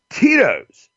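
high, punchy brand name; mock ad announcer hitting the brand; brand pitch. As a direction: sell the name like an ad.

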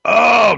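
loud, high gasping shout; loud high cry after swallowing vodka; shout after a shot. Real, not acted. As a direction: yelp as the liquor burns.

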